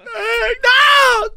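high, loud hammed-up shriek; reacting as the man stands up, in a high loud mock shriek; mock shocked cry. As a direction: squeal it in shock.

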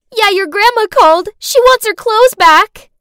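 high, loud snarky taunt; mean girl taunt. Acted.

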